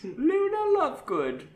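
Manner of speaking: put-on very high, drawn-out girl's voice; girl's character voice. A narrator's reading. Girl replies to Harry with her name, high character voice. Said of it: "her voice soft".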